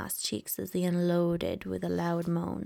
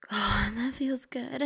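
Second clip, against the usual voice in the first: slow, higher pleasured moan; 5 semitones higher than usual. Acted. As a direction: moan the words out slowly. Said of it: "I moaned".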